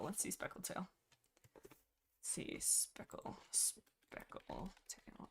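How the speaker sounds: whispered, drawn-out muttering; murmuring while searching. Real, not acted. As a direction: mutter it to yourself, hushed. Searching notes, murmuring to herself.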